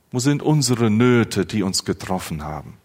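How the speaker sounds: ordinary talk, nothing marked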